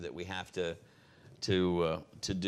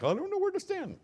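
much higher, squeaky mock confusion; confused congregant; 3 dB louder than usual. Pastor mocking a confused churchgoer in a squeaky, much higher voice. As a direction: play a lost churchgoer, pitched way up. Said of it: "if you're like".

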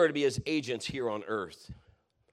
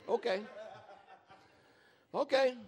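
amused okay; 8 semitones higher than usual. Retold or imitated. Mock brush-off after sceptic quits.

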